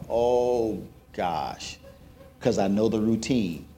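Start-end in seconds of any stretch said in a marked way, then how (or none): 0.1-1.8 much softer and lower groan of dismay; sinking despair. Retold or imitated. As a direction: let the heart sink into a soft low "oh gosh". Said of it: "my heart just sinks".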